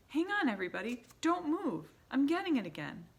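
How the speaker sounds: high, loud, urgent; urgent sniffing witch. A narrator's reading. Mildred keeping the witches still, high and urgent. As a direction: keep them frozen while she sniffs.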